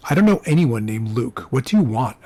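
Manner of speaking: ordinary delivery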